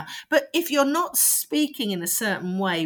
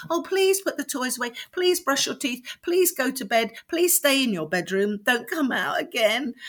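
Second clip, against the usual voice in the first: higher-pitched mock parental plea; pleading; 4 semitones higher than usual. Shown as a demonstration. Parent begging children to go to bed, mock pleading. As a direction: beg in a run of imploring requests. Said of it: "this kind of plea, pleading for, you know, pleading them".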